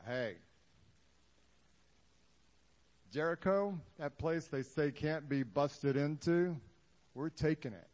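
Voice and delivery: rally the troops; rallying call. Acted. Leader recruiting fighters, a loud rallying call.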